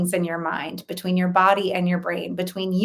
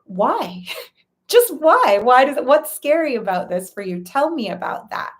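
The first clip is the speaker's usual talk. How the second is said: gently curious questions, breaking into a laugh, then higher and louder; curious kind friend; 5 semitones higher, 4 dB louder than usual. Retold or imitated. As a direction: ask like a friend who truly wants to know. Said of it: "you might be likely to be like".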